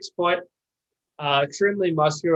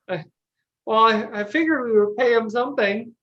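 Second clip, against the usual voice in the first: a light sheepish mumble, slightly higher; sheepish Jerry; 6 semitones higher than usual. Acted. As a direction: caught out, hedging awkwardly. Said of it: "Jerry looks down at his hat and he goes".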